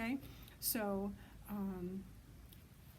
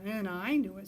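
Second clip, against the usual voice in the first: louder, stress on "knew", falling; eager owlet; 8 dB louder than usual. A narrator's reading. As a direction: insist on it, hitting "knew". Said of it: "said Percy".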